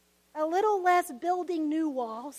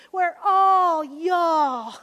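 loud, slow, voice cracking on all y'all; crying out; 11 dB louder, much slower than usual. Real, not acted. Preacher crying out loud and slowly to the congregation. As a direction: let the emotion break through.

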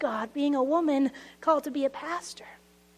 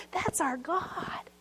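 tender awe; 7 dB quieter, much slower than usual. Real, not acted. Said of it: "repeated, slower".

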